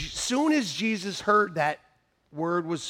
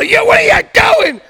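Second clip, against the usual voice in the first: high, very loud angry re-enactment; yelling; 15 dB louder than usual. Retold or imitated. Caller mistaking a real person for a computer, yelling. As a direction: bellow at the person on the line. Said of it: "I still yell".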